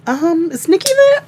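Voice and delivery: high put-on voice for the friend on the phone; imitating Crystal. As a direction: pitch it way up like the caller asking.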